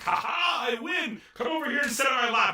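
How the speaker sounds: high, loud triumphant crowing; hammed-up victorious shout; gloating shout. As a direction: crow over the win at the top of your voice. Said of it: "and he's like".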